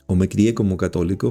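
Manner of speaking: ordinary talk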